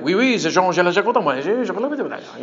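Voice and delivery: rattled-off mock French gibberish; fake French. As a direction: bluff your way through pretend French. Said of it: "I used to fake languages".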